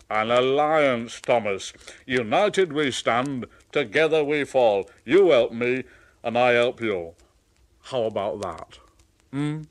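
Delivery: lightly voiced big engine; hearty Gordon. Gordon proposing an alliance to Thomas. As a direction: propose it grandly.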